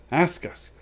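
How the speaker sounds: eager Gollum voice; Gollum pleading. A narrator's reading. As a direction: pester him like a hungry creature. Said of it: "said Gollum".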